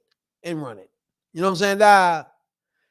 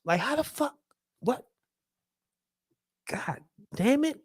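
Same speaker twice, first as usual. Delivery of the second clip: ordinary talk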